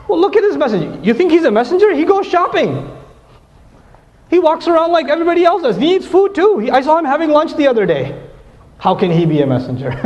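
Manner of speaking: high-pitched imitation of scornful doubters; hammed-up disbelief; mock incredulous scoffing. Acting out scoffers' argument against messenger.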